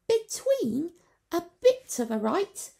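very high, loud, puzzled squeak; innocent creature. A narrator's reading. Creature asking the way, innocent. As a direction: ask it all innocence. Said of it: "began Sproggle".

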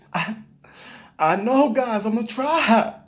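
hammed-up tearful whine, drawn out at the end; fake crying. YouTuber faking tears for viewers, mock tearful. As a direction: pretend to be on the verge of tears. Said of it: "You see the tear? Me neither, 'cause it's not there".

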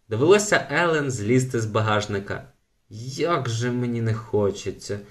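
ordinary delivery, nothing marked

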